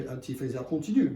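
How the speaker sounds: ordinary talk, nothing marked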